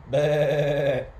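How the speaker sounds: loud, wavering baa; sheep bleating. A narrator's reading. Storyteller voicing the new sheep, bleating. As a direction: imitate a sheep with one bleat. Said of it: "and the sheep baaing".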